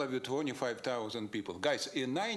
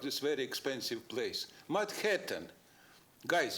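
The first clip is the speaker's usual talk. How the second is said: normal talking voice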